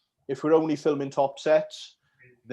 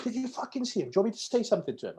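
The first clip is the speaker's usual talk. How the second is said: retold annoyed friend; 10 semitones higher, faster than usual. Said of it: "she's like".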